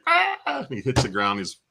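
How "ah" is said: high sharp yelp of pain; hammed-up shriek of a tased man; mock tased scream. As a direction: imitate a man getting tased, high and loud. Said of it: "and he's like".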